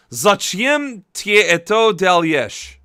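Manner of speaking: slow careful repeat of Зачем ты это делаешь; copying Russian. Shown as a demonstration. Vlogger copying a translation app, careful Russian. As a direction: imitate the app's Russian, slowly. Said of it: "there we go".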